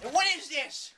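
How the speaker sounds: high, exclaimed question; incredulous outcry. Real, not acted. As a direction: pitch it up in disbelief. Exclaiming a second time.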